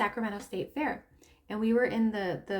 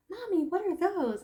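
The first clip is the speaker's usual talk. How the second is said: put-on higher, innocent child's question; little daughter; 7 semitones higher than usual. Daughter asking mommy at the fair, high child voice. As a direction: ask like a curious little girl. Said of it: "she's like".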